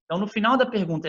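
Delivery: ordinary talk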